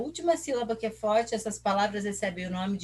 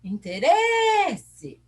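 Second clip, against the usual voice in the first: RE in "Interesse" drawn out high and loud; stress on RE; 8 semitones higher, 5 dB louder, much slower than usual. Shown as a demonstration. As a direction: call out "Interesse", holding RE very long. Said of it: "Quem que é forte?".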